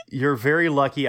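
ordinary talk

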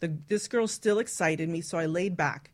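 usual speaking voice

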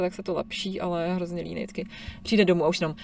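usual speaking voice

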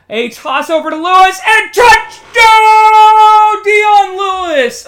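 huge high shout with a long held "touchdown"; touchdown call. Pretend. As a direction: belt out the score, stretching the word. Calling a touchdown.

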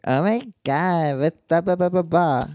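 put-on slow, drawling Valley Girl; mock ditzy-girl impression; Valley Girl accent. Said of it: "the basic Valley Girl type of accent".

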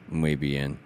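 deep, soft, glum mumbled reply; Fletcher, sour-faced. Retold or imitated. Scared kid answering the camp lady, low and sour. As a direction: drop it low and quiet, sour and reluctant. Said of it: "Like, kinda sour-faced".

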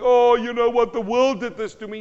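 loud, high whiny excuse-maker; mock excuse. Preacher mocking a churchgoer who blames the world, loud whiny voice. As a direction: blame the world in a put-on whine.

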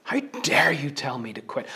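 controlled, indignant challenge; angry defiance. Acted. David answers whoever told him to quit, angry. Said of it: "He is angry that someone has come and dared".

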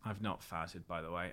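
low, quiet correction; imitated understated, low-voiced denial; deadpan retold denial. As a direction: drop low and soft to deny it. Retold reply of accused man.

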